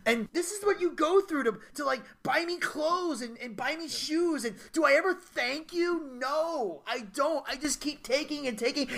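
self-reproaching rant. Retold or imitated. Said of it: "Kevin goes on this rant".

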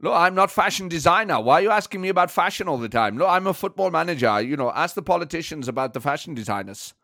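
irritated German manager impression; comic, indignant football boss; Thomas Frank. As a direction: bristle at the interviewer in German-accented English. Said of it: "It's a good impression".

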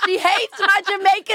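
high, loud, through laughter; words breaking into real laughter; laughing hard. Real, not acted. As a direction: squeal it out while cracking up. Cracking up at rejected accent.

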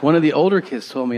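ordinary talk, nothing marked

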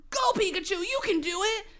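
high-pitched, urging cry; cheering trainer. Acted. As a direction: cheer on your battling partner. Hero cheers Pikachu on.

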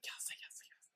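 breathless whispered yes, yes, yes. Retold or imitated. Voicing the wedding planner watching the proposal, whispering. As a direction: whisper it to himself, delighted. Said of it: "He's like".

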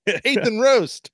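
slow, high announcer read of the sponsor; announcer plug. Pretend. [name] plugging the sponsor like an ad read. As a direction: sell it like a radio ad.

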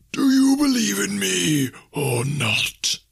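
loud, high, slow spectral wail; commanding ghost. A narrator's reading. Ghost demanding Scrooge believe, loud haunting challenge. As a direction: demand belief like a wailing phantom.

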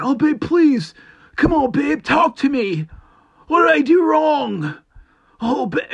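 high, loud, whiny pleading; begging boyfriend. Retold or imitated. Mocking the average Joe begging his upset girlfriend, high whiny plea. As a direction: plead like a desperate pushover. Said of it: "your average Joe is gonna be, give in to that".

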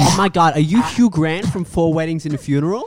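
loud, high starstruck gushing; hammed-up amazed cop spotting a celebrity. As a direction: burst out in star-struck disbelief. Cop recognises a film star.

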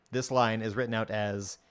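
usual speaking voice

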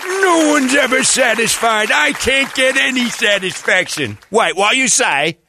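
high, slow hammed-up rocker wail; Jagger. Orderly Jagger stumbling on song lyrics at work, high drawn-out wail. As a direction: draw the words out high, half-singing. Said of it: "Orderly Jagger".

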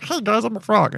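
high-pitched little frog voice; frog co-pilot. Pretend. Frog co-pilot greets the passengers, playful high frog voice. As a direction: jump up high as a cartoon frog. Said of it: "my co-pilot over here is a frog".

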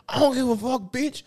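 high, loud mock sassy worker; mocking rude cashier. Son mimics the rude drive-thru worker, high.

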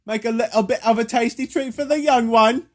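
hammed-up Cockney bargaining; mock Cockney accent. Offering a treat in trade, mock Cockney accent. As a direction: haggle like a stage Cockney. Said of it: "like, a Cockney accent".

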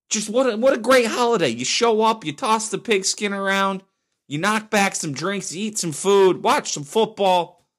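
high-pitched, gushing rave about the holiday; fired up. Real, not acted. Host raving about Thanksgiving to his listeners, fired up. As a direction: get carried away with joy, voice climbing high. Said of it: "I got a little fired up there over Thanksgiving".

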